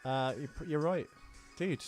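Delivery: ordinary talk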